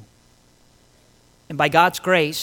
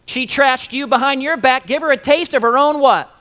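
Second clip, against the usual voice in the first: high, loud goading; acted egging-on, pitched up and punchy; voices of vengeance; 9 semitones higher, 7 dB louder than usual. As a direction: rile someone up to get revenge. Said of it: "we constantly hear those voices".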